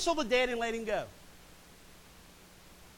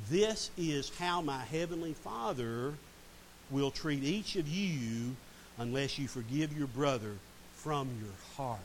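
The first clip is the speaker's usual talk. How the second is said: slow, softer, grave warning; solemn and slow; 5 semitones lower, 4 dB quieter, slower than usual. Acted. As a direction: lay it down gently but gravely. Solemn quoting of Jesus' warning.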